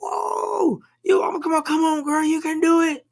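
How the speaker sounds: high-pitched mock sideline cheering; retold cheering scream. Dad cheering on his kid at a game, mock screaming. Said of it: "I'm out there screaming".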